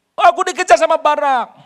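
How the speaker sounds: high, loud panicked telling; alarmed plea. Retold or imitated. Sisera begging Yael, high and loud. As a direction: blurt out he's being chased.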